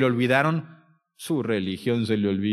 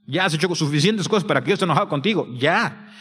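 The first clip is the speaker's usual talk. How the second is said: rising, louder reproach as God's voice; indignant God; 6 semitones higher, 4 dB louder than usual. Acted. God rebukes the sinner for mocking salvation, indignant. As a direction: build to an incredulous, high-pitched challenge. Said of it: "porque Él está diciendo".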